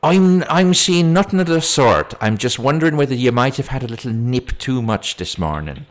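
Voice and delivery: high, loud start, easing slightly; put-on copper hinting at drunkenness; insinuating policeman. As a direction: deny it, then needle her. Policeman insinuates she drank.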